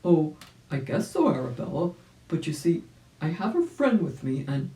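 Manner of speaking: hesitant, kindly reply; old rabbit. Acted.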